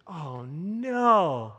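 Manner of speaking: acted low, sinking dismay. Pastor recalls the five thousand boulder moving, mock dismay. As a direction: let a mild dread sink in, drawn out.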